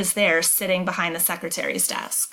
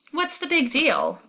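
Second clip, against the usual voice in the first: tossed-off "What's the big deal?" with a high peak on "big", falling away; offhand; 7 semitones higher than usual. Acted. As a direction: shrug it off lightly. Brenna brushing it off.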